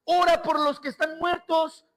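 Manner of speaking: high, loud preaching at full force; fervent preaching. Acted. Pastor preaching to the church at the climax, full force. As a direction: build the congregation up with fire.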